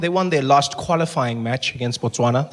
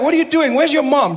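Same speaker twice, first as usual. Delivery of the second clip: much higher, incredulous telling-off; mock scolding; 9 semitones higher than usual. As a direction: scold him like a worried parent. Scolding a bleeding player.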